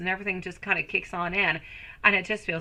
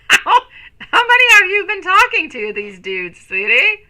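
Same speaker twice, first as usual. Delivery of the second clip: much higher and louder, through laughter; laughing tease; 11 semitones higher, 13 dB louder than usual. Real, not acted. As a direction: squeal the question while laughing. Laughing, teasing a viewer.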